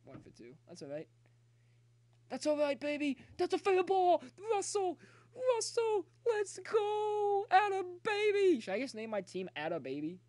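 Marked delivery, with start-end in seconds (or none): 2.3-8.6 high, loud cheering for his batter; excited cheering. Real, not acted. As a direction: yell him home, fired up. Cheering on hit, calling player.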